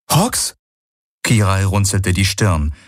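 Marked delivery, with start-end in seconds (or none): 0.1-0.6 high, puzzled echo; unsure what he means; puzzled question. A narrator's reading. She repeats questioningly.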